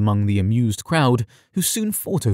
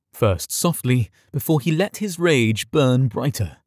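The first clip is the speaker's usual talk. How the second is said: usual speaking voice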